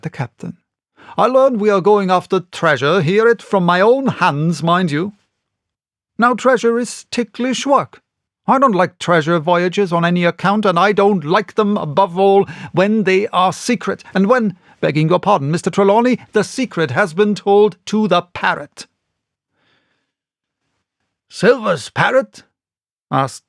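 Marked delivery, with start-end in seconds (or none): 1.2-18.9 higher, louder, insistent; heated captain. A narrator's reading. Captain scolding the squire about secrecy, raised and heated. 21.3-22.4 puzzled squire. A narrator's reading.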